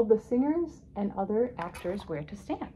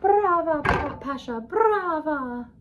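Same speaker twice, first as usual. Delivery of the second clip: high, loud opera-house acclaim; ringing, drawn-out shouts of applause; cheering; 9 semitones higher, 8 dB louder, slower than usual. A narrator's reading. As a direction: cheer the star from the audience. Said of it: "Everyone was applauding and cheering".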